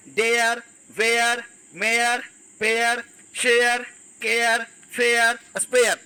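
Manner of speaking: डेयर, वेयर, मेयर slowly, each with an EH-YAR glide; chant-like list of पेयर, शेयर, stretching every glide; EYA sound. Shown as a demonstration. Teacher reviewing the eya words, slow word drill. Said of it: "ए मतलब एया भी".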